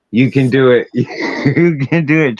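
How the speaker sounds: retold self-pep talk through a laugh; psyching self up. Climber to himself at the tower, amused self-encouragement. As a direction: coax yourself on, laughing midway. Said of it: "like, psych myself up".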